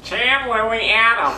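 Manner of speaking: pinched nose voice; holding nose. Pretend. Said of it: "holding your nose".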